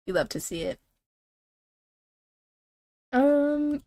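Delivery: usual speaking voice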